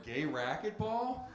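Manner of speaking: confused. Retold or imitated. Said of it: "I'm like".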